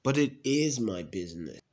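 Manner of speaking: usual speaking voice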